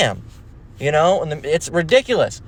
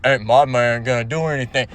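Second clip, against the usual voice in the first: low, mock masculine woman's drawn-down voice; deep-voiced woman; 4 semitones lower than usual. Mocking masculine women who act like studs, deep voice. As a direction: imitate a tough woman talking deep. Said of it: "with your deep-ass voice self".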